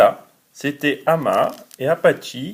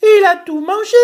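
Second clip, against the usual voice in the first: very high, fast squeaky little bear; tiny bear's voice; 4 dB louder, much faster than usual. Acted. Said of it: "de sa toute petite voix".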